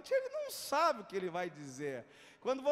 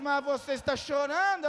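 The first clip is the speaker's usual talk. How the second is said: loud, insistent mimicked question; emphatic retold question; 4 semitones higher, 8 dB louder than usual. Preacher echoing the attendant's question back, loud and emphatic. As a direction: throw the question out loudly to the crowd.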